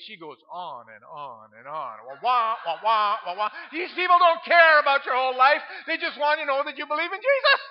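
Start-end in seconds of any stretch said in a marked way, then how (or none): 2.1-3.5 mock droning. Mocking her long testimony. 3.7-7.6 high, loud impatient rant; exasperated. Retold or imitated. Freezing in river, impatient thoughts.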